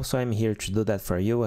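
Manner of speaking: ordinary delivery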